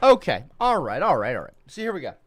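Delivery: usual speaking voice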